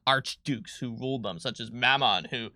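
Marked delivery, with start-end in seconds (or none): none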